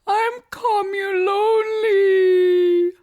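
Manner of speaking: high, loud, slow crying wail; sobbing wail. A narrator's reading. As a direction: wail through the tears. Sobbing cloud spirit.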